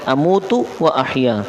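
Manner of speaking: "اموتو" کا آخری واو صاف، پھر "و احیا" آہستہ کھینچ کر; "اموتو و احیا" ٹھہر ٹھہر کر، واو واضح ادا کریں; اموتو و احیا کا درست تلفظ. Shown as a demonstration. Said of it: "پہلے والے میں واو نہیں ہے". ("اموتو و احیا" استاد کی طرح آہستہ صاف تلفظ)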